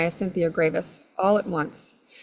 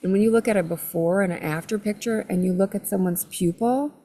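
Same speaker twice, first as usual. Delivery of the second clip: ordinary delivery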